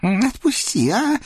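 high, begging little devil voice; pleading devil. Acted. Devil Naum begs the Cossack to let him go, high and whiny. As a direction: whine for release like a small imp. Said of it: "взмолился Наум".

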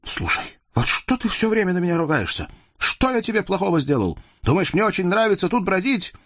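high-pitched indignant protest; exasperated youth, pitch pushed up; aggrieved young man. A narrator's reading. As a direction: complain at being blamed all the time. Yusuf protests at being blamed.

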